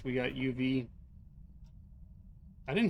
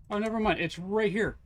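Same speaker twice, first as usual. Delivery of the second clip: excited discovery; 8 semitones higher, 7 dB louder than usual. Real, not acted. Finds the bone, exclaims.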